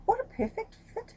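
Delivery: high squeal of fake delight; mock delight. Courtiers flattering the emperor, high mock gush. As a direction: gush with exaggerated praise.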